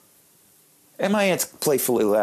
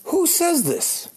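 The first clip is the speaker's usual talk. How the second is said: high, drawn-out incredulous cry; slow, high-pitched mock amazement; mock disbelief; much slower than usual. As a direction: squeal it in comic disbelief. Mock disbelief at grandma's words.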